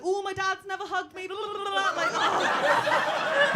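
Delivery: put-on high, slurred drunk rambling; drunk sloppy voice. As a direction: overshare drunkenly. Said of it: "going up to guys".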